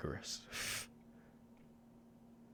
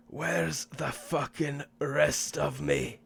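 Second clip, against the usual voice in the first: put-on mild Scottish accent; 6 semitones higher, 4 dB louder, slower than usual. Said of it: "scream in a Scottish accent".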